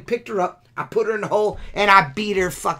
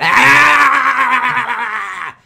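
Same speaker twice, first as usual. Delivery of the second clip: frustrated yell; 4 semitones higher, 6 dB louder than usual. Real, not acted. Venting at rival on podcast.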